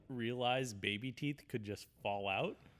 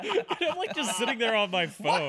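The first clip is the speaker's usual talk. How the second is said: laughing high and loud through the words; cracking up mid-story; 11 semitones higher, 10 dB louder than usual. Real, not acted. Telling friends about a spying wife, laughing hard.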